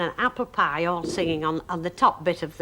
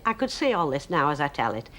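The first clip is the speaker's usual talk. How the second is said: ordinary delivery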